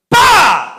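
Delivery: loud, high imitated blast "pa"; explosion bang. Telling the audience about the blast, a loud imitated bang. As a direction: burst out with a sudden explosion noise.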